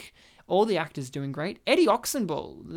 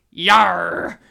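pirate yar; 9 dB louder than usual. Retold or imitated. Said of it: "the perfect comment".

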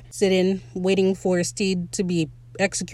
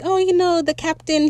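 mock innocent pirate; 8 semitones higher than usual. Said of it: "he's like".

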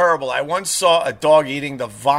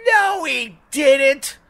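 slow, high mocking imitation; mock denial; 9 semitones higher, much slower than usual. Dog owner denying the attack, mock defensive voice. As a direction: draw out the denial at high pitch.

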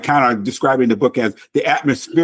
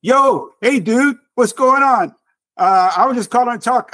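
raised, casual voice of the sister; the cheerful sister calling to chat, voiced higher; sister's voice; 9 semitones higher than usual. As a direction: lift into her easygoing greeting. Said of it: "And she says".

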